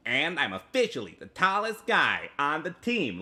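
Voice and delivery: high-pitched cartoon bird kid; baby voice. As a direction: read it like a squeaky little child, drawn-out opening. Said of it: "you're going for baby voice".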